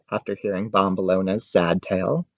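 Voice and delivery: ordinary delivery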